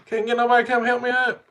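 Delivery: high, loud yell to someone far off; loud, high holler from the ground; retold scream for help. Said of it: "screaming at her".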